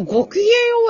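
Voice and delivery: very high, refined greeting; genteel schoolgirl greeting. Retold or imitated. Recalling a greeting from her girls' school, high genteel imitation. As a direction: put on a high young-lady voice.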